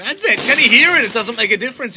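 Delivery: loud, high hype call; esports caster. Real, not acted. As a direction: punch it out live. Hyped live play-by-play call.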